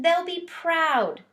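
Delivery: high, slow squeaky reassurance; a small creature squeaking comfort; hamster squeak. A narrator's reading. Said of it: "I squeaked".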